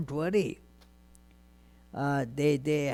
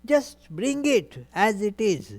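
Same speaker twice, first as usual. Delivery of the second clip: higher-pitched questioning, then insistent request; lightly voiced insistence, pitched up; Krishna; 8 semitones higher, 4 dB louder than usual. Krishna asks Draupadi for the plate, lightly insistent. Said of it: "Draupadi confided that nothing is left there".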